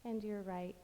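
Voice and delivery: slow, soft meditation voice with long pauses; guided meditation. Acted. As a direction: lull them through a body scan, phrase by unhurried phrase. Leads guided body-scan meditation.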